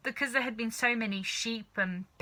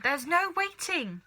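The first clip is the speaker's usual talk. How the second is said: high, slow, insistent cry; impatient cry; 8 semitones higher than usual. A narrator's reading. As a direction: brush the boy aside. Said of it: "cried Mister Cranky".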